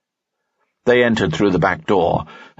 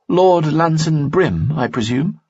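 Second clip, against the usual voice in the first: put-on higher, slow and formal young woman; polite greeting, pitched up; Dina's voice; 10 semitones higher than usual. Dina speaks in story.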